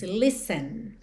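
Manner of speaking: "listen" said "lissen", the t silent; "listen" as "lis-en", no t sound. Shown as a demonstration. Teacher showing "listen" has no t sound. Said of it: "o som aqui é de dois S's".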